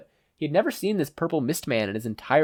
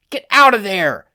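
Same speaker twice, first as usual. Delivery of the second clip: higher, loud urgent yell; 9 semitones higher, 11 dB louder than usual. Acted. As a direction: shout him out of danger. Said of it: "Banjo yelled".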